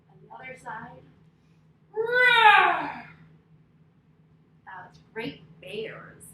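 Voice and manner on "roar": big loud bear roar, higher. Shown as a demonstration. Yoga teacher to kids in bear pose, loud roar. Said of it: "let's roar for the bear".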